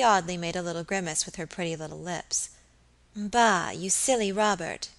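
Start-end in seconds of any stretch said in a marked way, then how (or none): none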